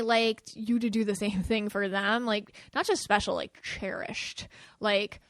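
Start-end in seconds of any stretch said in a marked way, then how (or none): 3.4-4.5 soft, slow whisper on cherished; hushed emphasis. Shown as a demonstration. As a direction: breathe the word like a secret. Stressing the word cherished.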